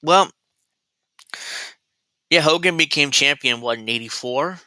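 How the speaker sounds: usual speaking voice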